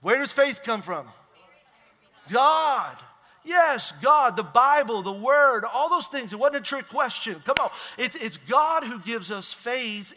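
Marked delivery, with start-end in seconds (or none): 2.3-6.3 louder, higher emphatic answer; preaching emphasis. Real, not acted. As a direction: drive the answer home. Answering his own question emphatically.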